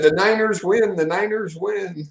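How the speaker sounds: high, loud sing-out cheer, slowing on the repeat; excited cheer. Retold or imitated. Imitating a fan announcing the Niners win, excited cheer.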